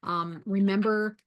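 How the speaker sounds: usual speaking voice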